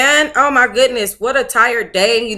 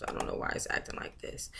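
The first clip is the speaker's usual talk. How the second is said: whisper; 21 dB quieter, faster than usual. Real, not acted. Muttered frustration at Kindle.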